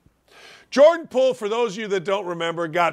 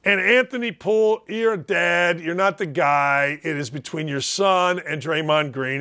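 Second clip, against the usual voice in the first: usual speaking voice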